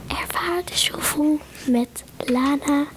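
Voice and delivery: breathy unvoiced ASMR whisper. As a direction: whisper close to the mic, ASMR style. ASMR segment with Lana and Gwen.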